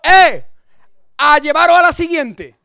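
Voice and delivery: hammed-up laughing yell; pastor's shout. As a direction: shout the next job at the sweaty youths. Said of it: "¿sabes qué le dice Pedro?".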